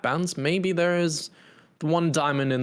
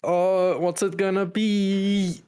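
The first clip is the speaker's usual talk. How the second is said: higher, drawn-out wondering; slow, raised-pitch anticipation; playful suspense; 6 semitones higher, slower than usual. Pretend. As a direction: stretch it out to tease the reveal. Playing up suspense before map reveal.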